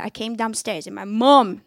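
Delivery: ordinary talk, nothing marked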